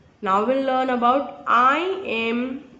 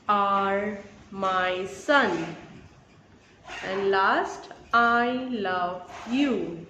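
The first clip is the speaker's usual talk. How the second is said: each word set apart by pauses, last lines drawn out; slow teacher dictation; slower than usual. Shown as a demonstration. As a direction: read it slowly word by word for children. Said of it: "Next picture you can see".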